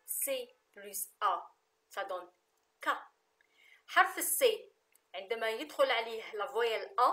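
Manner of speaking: ordinary talk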